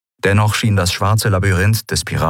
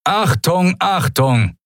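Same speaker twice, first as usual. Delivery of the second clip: high-pitched alarm call over speakers; thundering loudspeaker announcement; 10 semitones higher, much slower than usual. A narrator's reading. Hidden loudspeaker alerts the pirate camp, thundering announcement. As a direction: sound the alert like a tannoy voice. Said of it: "donnerte plötzlich die Stimme eines Menschen über versteckte Lautsprecher".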